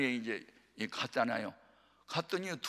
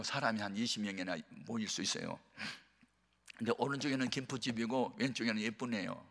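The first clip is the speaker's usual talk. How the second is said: normal talking voice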